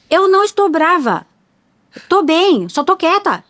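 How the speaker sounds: high, loud protest that she's not angry; loud insistent denial. Retold or imitated. Mother insisting to her son she is not angry, loud high protest. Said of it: "Esse é o meu tom alto".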